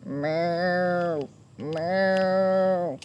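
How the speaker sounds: low drawn-out moo; cow mooing. A narrator's reading. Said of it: "There was a big brown cow".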